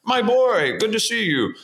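Mike Nichols impression. Director greeting the young actor, high and delighted.

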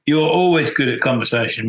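ordinary talk

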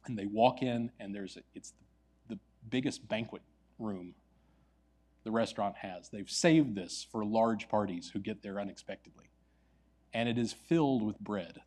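ordinary delivery

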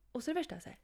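hushed whisper. Real, not acted. Whispering before revealing the shocking scene. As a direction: lean in and whisper.

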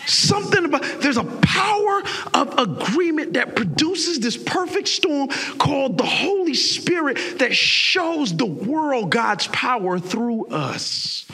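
high, slow, building preacher's cry; rising sermon shout; preaching shout. Real, not acted. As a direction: stretch it out and lift it.

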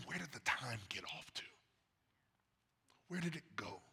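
hushed, tearful wondering; whispered sorrow. Real, not acted. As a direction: breathe it out, nearly whispering. Tearful reflection on lost time.

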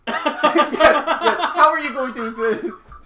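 high, loud and laughing. Real, not acted. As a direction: laugh while asking. GM laughing at player.

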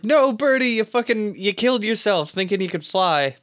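mock-dismayed yell, high and loud then easing; retold alarmed cry. Podcaster recaps Cage seeing Birdie jump off the roof, mock dismay. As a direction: call out in hammed-up dismay, pitch jumping up at the start.

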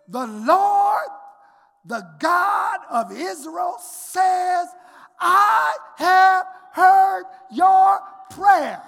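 booming, slow preached words of God; full-force sermon shout; preaching God's voice. Acted. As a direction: proclaim it as God thundering.

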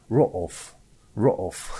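roof split into row off, two separate O sounds. Shown as a demonstration. Explaining the diaeresis to a friend, roof split in two. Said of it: "să nu mai fie pronunțat roof".

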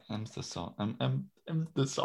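"emphasize" muddled and broken off, very softly; stumbling pronunciation. Real, not acted. Player losing grip on the quiz word, stumbling over it. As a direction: trip quietly over the sounds of "emphasize". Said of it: "I can't even pronounce it now".

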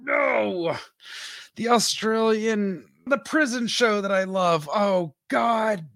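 high, exasperated correction; playfully livid. Real, not acted. Correcting a co-host about her favorite prison show, exasperated. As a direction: draw it out in high-pitched exasperation. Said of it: "Now I'm livid".

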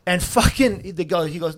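ordinary talk, nothing marked

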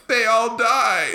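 high, loud, drawn-out mock cry; distraught wail. Retelling a viewer's grief at a TV massacre, mock wail. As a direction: wail it in imitated shock.

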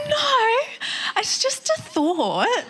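very high, half-crying protest, slowing at the end; squealed denial. Retold or imitated. Wife insisting to her husband it's nothing, high squealing protest. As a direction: squeak the denial in mock panic. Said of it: "I said".

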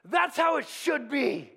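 slow, pompous churchy voice that swells up; hammed-up pious agreement, drawn out; smug churchgoer. Pastor mocks churchgoers agreeing with the train, pompous and slow. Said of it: "we'll be in church and we'll go".

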